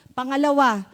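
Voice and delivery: ordinary talk, nothing marked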